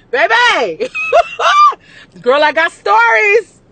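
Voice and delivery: high, loud cackling laughter; shrieking laugh. Real, not acted. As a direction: laugh till you scream it out. Shrieking laugh about stories.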